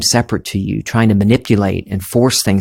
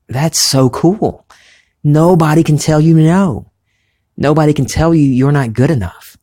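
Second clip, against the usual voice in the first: excited; 7 semitones higher, 4 dB louder than usual. Real, not acted. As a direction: burst out with delight at the idea. Delighted outburst about inner freedom.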